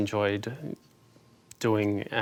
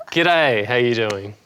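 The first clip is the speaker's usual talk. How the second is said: a broad Aussie g'day, louder and rolled into one; Australian greeting; 4 dB louder, slower than usual. Shown as a demonstration. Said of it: "I bet you don't have too many Australians on here".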